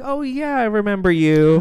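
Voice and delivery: lightly voiced sugary greeting; a hint of the wife, high and bright-faked politeness; mock polite wife.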